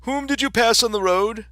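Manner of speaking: high, loud storybook king. Acted. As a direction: demand it as a king.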